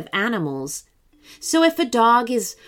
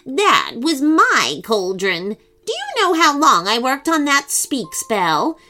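put-on a spiteful old witch relishing it; mean witch voice; 5 semitones higher than usual.

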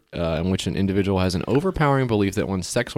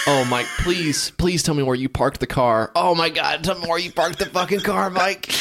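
pleading whine; 7 semitones higher than usual. Acted. Pleading acted voice.

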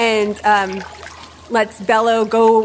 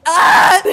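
startled yelp; 6 semitones higher, 8 dB louder than usual. Acted. Startled by the note.

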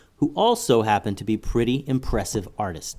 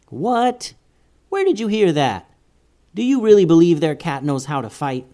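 high doubtful what, then louder; surprised disbelief; 5 semitones higher, 4 dB louder than usual. Acted. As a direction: scoff at a silly rumor. Brother doubts karate cat.

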